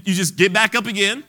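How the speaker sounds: emphatic exhortation. Acted. Preacher urging listeners to use the word, emphatic. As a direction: press the command home.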